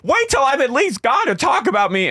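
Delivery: high, hammed-up huffy voice; mock Shannon indignant. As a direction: squeak it out, offended. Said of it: "she comes back and she goes".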